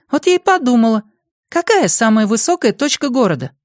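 put-on very high-pitched girl's voice; high girlish explanatory voice; girl explaining. A narrator's reading. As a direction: lift the voice high as a girl reasoning out her idea. Voices Nori explaining her idea.

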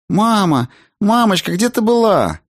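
высокий детский голосок; пискни как маленькая дочка, зовущая маму; встревоженная малышка. A narrator's reading. (встревоженный детский голосок)

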